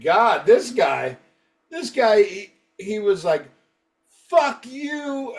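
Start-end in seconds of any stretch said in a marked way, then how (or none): 4.3-5.3 high-pitched mock fan outrage; high, hammed-up insult; retold angry threat. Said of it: "this was fucking brutal".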